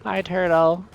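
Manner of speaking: light up at the sight of it; excited. Real, not acted. Spotting a turtle in the ocean, loud delighted greeting.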